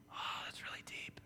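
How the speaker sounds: hushed, breathy mock profundity; mock deep whisper. Shown as a demonstration. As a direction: whisper it like it's profound. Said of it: "I can speak with emphasis".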